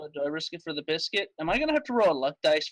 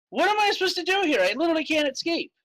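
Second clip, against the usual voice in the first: much higher, exasperated complaint; exasperated protest; 12 semitones higher than usual. Real, not acted. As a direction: protest in a high-pitched, helpless outburst. Player protests being stuck in game.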